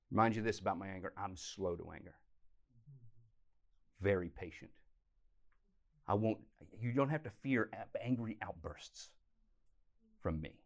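calm them, as God promising no outbursts; gentle reassuring God. Acted. Preacher voicing patient God.

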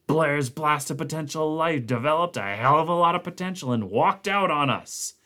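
gruff angry report. Acted.

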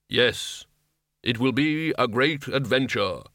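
slow, solemn Death voice; measured, unhurried, otherworldly Death; grim reaper voice. A narrator's reading. Death answers.

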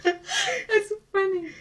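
high, slow squeak through laughter; laughing hard. Real, not acted. Host tickled by the haircut lie, laughing hard. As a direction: let the words wheeze out on laughter.

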